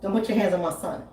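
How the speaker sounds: low, soft and deadly calm; firm quiet warning. Retold or imitated. Comedian to stranger, quietly warning her off. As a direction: calmly warn her off your son.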